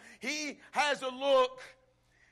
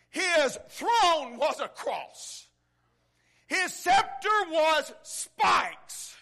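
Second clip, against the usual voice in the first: slow, loud, higher builds; preaching climax; 4 semitones higher, 6 dB louder, slower than usual. Real, not acted. Preacher building to the climax for the church, slow loud crescendo. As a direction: drive each phrase home.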